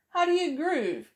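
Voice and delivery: ordinary delivery, nothing marked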